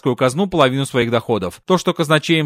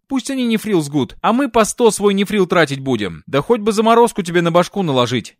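plain reading voice

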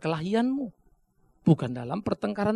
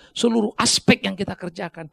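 ordinary talk, nothing marked

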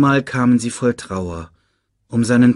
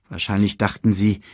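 usual speaking voice

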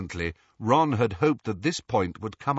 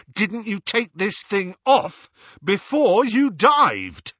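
breathless, high and loud; gasping indignant shout; panting; 9 semitones higher, 8 dB louder, slower than usual. Acted. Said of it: "panted Ron".

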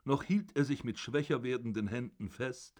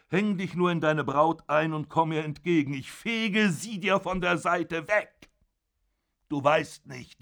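put-on loud, raised furious old father berating his son; zorniger alter Vater; 6 semitones higher, 7 dB louder than usual. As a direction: bellow down at your son from the bed. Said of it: "father taunts Georg". (wütender Vater, laut und erhoben)

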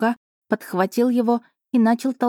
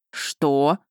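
taken aback; 4 semitones lower than usual. Acted. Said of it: "опешил он".

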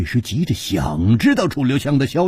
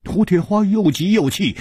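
ordinary delivery

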